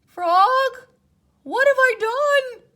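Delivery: very high, loud anguished cry. Acted. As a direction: cry out in guilt. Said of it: "cried Toad".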